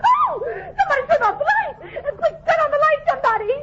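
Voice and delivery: high shout for someone to turn on the light; panicked kid yell. Acted. Kid in the dark calling for light, high panic.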